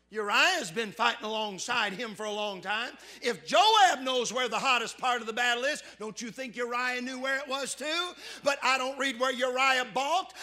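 loud, high preaching cry; fiery pulpit delivery; full-force preaching. Acted. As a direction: preach it out to the back pews.

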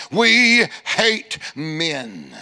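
slow, low mock hostile voice; mock man-hating women. Imitating the hostile hairdressers, slow and low. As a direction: drag it out low like a grudge. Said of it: "had that look on their face".

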